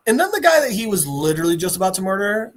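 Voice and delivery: ordinary delivery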